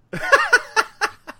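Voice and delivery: high squealing laugh. Real, not acted. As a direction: burst into a laugh. Laugh at joke.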